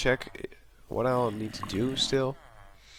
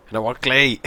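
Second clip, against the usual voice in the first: louder, wanting clay; exclaiming; 8 dB louder than usual. Real, not acted.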